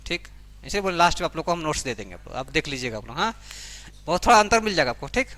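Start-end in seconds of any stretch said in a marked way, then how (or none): none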